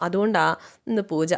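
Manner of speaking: usual speaking voice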